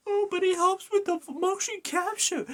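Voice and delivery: very high, silly mocking voice; mock objector. Gamer mimicking fans defending a face change, high mocking voice. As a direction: parody the defenders in a squeaky high pitch.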